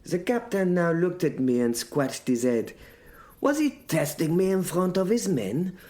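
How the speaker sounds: ordinary delivery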